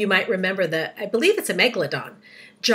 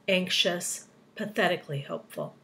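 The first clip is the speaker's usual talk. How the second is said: ordinary delivery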